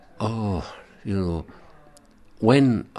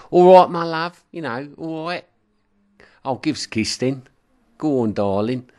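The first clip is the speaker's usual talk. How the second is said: lightly mocking Essex accent, higher and louder; 8 semitones higher, 8 dB louder than usual. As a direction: do a cheeky Essex greeting in an Essex accent. Imitating Essex speakers.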